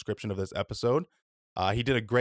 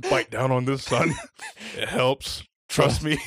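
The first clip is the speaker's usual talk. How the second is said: gruff drill sergeant; 3 semitones higher, 4 dB louder than usual. Retold or imitated. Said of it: "Starship Troopers?".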